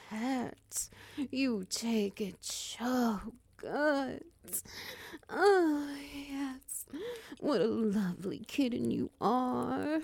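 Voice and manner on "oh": high moan; loud pleasured moan. Acted. Pleasure during roleplay.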